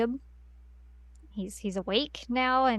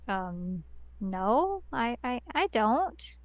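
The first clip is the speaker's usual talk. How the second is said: softer, halting and unconvincing; hesitant denial; 5 dB quieter, much slower than usual. Retold or imitated. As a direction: stammer out an awkward, quiet denial. Acting out Mary's awkward denial.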